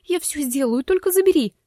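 shaky, frightened plea, rising high; trembling voice. Acted. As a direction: beg in a trembling voice. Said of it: "дрожащим голосом попросила Алана". (дрожащим голосом)

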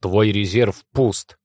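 slow, emphatic exclamation; shouted warning. A narrator's reading. Lord warning the injured father, emphatic cry. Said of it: "крикнул лорд Арван".

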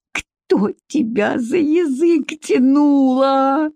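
slow, high, drawn-out wail; moaning. A narrator's reading. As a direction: moan the reproach as strength drains away. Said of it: "стонущим голосом повторил Егор".

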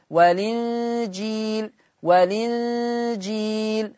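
والإنجيل read slowly as walinjil, the lam with kasra; naql transfer demo. Shown as a demonstration. Teacher models naql on والإنجيل. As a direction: slowly voice والإنجيل with the hamza dropped. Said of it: "هكذا".

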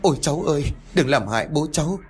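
high frightened begging; fearful plea. A narrator's reading. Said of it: "Bà Bưởi thì sợ sệt".

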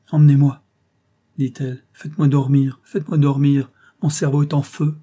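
a woman at breaking point pleading to be taken away; desperate plea. A narrator's reading. Lady Audley pleads with Robert to let her sleep, desperate.

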